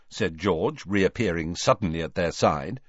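ordinary delivery

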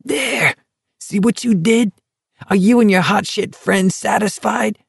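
high, lightly voiced shrill rant; shrewish accusation. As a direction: keep the shrewish shriek going. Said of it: "Barkovich screamed shrewishly".

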